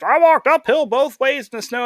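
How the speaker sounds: hammed-up grandparent bragging. Grandparents lecturing about their hard old days, high mocking voice. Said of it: "your grandparents".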